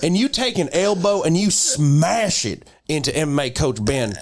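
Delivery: hammed-up US Southern accent. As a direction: sell it like a fired-up Southerner. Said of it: "Southern, U S, English, Southern".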